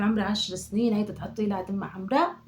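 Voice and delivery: ordinary delivery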